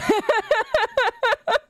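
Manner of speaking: high-pitched burst of laughter; laughing high. Real, not acted. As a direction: crack up in a high giggling run. Streamer cracks up on stream.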